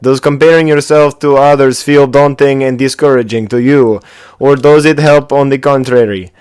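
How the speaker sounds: put-on booming amateur Russian accent. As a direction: read the email aloud in a mock Russian accent. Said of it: "read my email in a Russian accent, please".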